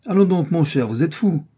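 loud, high scornful scoff; astonished contempt. A narrator's reading. Stranger scoffs at the young man, amazed and scornful. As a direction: dismiss him as a madman. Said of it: "étonnement que de mépris".

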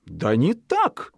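slow, exasperated fox voice; fox annoyed. A narrator's reading. Fox losing patience with Zhikharka, slow exasperated voice. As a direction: show growing annoyance. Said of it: "говорит лиса".

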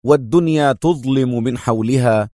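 usual speaking voice

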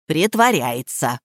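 slow, low, dismissive statement; categorical verdict. A narrator's reading. Nika dismisses the old woman's illness, categorical. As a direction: declare it slowly, allowing no argument. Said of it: "безапелляционно заявила Ника".

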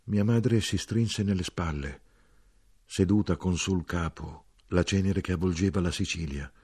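plain reading voice

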